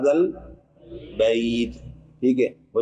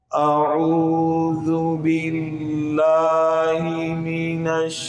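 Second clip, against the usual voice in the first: slow, drawn-out melodic chant, higher than speech; Quran recitation; 6 semitones higher, 4 dB quieter, much slower than usual. Shown as a demonstration. Teacher chanting the verse for students, slow melodic recitation. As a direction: intone each word long and melodic.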